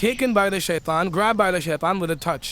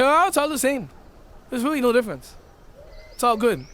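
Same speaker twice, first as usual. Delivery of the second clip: tag; 6 semitones higher than usual. Acted. Acting out sophisticated justifiers.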